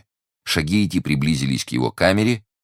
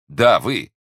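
loud, high retort; emphatic insistence; 6 semitones higher, 7 dB louder than usual. Acted. Investigator insists to the puzzled prisoner, emphatic and loud. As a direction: throw it back forcefully.

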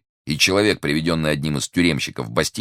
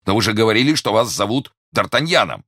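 raised, louder, higher protest; exasperated insistence; 9 semitones higher, 6 dB louder than usual. Acted. Investigator confronts the prisoner over his name, exasperated. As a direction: argue it back heatedly.

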